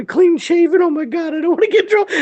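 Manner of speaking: high, frantic mock panic; squeaky, worried imitation; panicked muttering to self. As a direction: imitate a nervous man fretting at the mirror. Panicking subordinate checking his shave.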